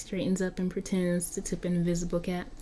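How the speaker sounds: ordinary talk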